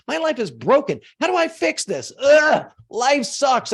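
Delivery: high, panicky whining; hammed-up self-pity, pitched high; whiny despair. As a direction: wail like a self-pitying loser, mock. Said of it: "you're like".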